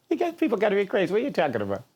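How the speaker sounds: fast, high burst of disbelief; quick incredulous protest. Retold or imitated. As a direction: rattle it off in shocked outrage. Shocked by segregation at nineteen.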